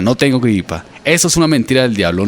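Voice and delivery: ordinary delivery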